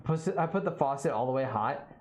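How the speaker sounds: ordinary talk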